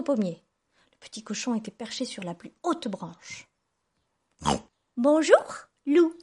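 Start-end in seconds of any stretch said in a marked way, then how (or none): 5.0-6.1 high, loud piglet greeting; pig calling out. A narrator's reading. Pig up the tree to the wolf, calling out. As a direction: call down in a piglet voice. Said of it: "cria le cochon".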